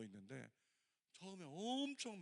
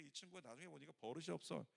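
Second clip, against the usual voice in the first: normal talking voice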